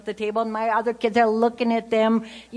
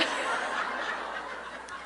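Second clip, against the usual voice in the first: breathy silent laugh; 11 dB quieter than usual. Real, not acted. Laughs at not remembering her.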